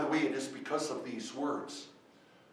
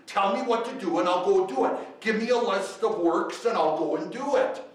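full-force preaching; 6 semitones higher, 9 dB louder than usual. Acted. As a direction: drive each question home to the congregation. Preacher presses point loudly.

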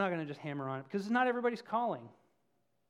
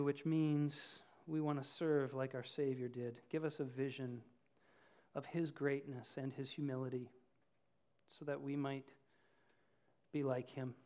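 prayer; 5 semitones lower, 5 dB quieter than usual. Real, not acted. Closing prayer.

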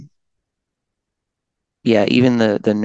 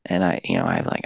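normal talking voice

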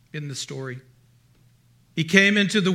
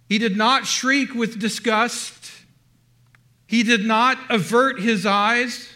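preaching at full force; 3 semitones higher than usual. Acted. Preacher telling the church how Christ received his bride, fervent. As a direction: proclaim each "did not" line with rising fervor.

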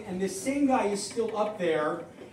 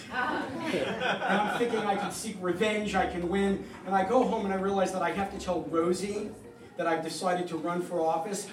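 usual speaking voice

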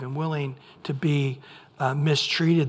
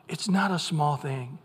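normal talking voice

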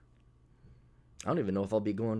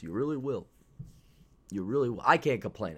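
ordinary talk

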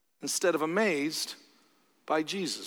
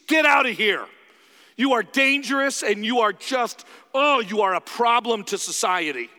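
loud, higher, hostile shout at Jesus; hostile outburst; 5 semitones higher, 6 dB louder than usual. Retold or imitated. Crowd telling Jesus to leave, loud hostile shout. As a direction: drive him away like a threat. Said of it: "two normal responses to Jesus".

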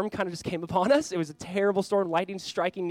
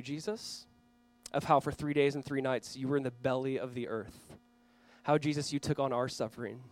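quiet prayer; 3 semitones lower, 4 dB quieter than usual. Acted. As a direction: pray it softly for the congregation. Leads a prayer.

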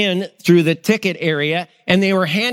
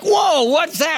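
high, amazed exclamation; pitched-up astonished whoa; 10 semitones higher, slower than usual. Retold or imitated. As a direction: sound floored at the news. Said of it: "And I'm like".

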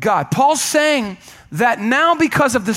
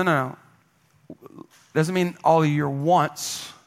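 normal talking voice

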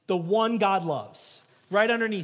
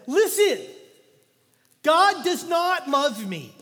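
much higher and louder, slow despairing cry; emotional outburst; 7 semitones higher, 6 dB louder, slower than usual. Retold or imitated. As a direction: break open with the pain of what he's done. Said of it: "he emotionally said".

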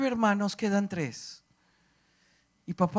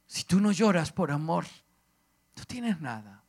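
ordinary talk, nothing marked

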